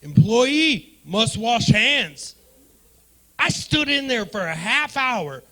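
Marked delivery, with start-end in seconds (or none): 0.0-2.3 "employee must wash hands" read out loud and very slowly, as if sounding out a sign; slow mock reading. Shown as a demonstration. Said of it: "not even employees".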